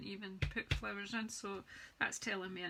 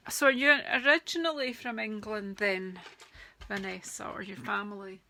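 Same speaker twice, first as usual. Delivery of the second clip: loud excited question; 12 dB louder than usual. Real, not acted. Reacts to a viewer's comment.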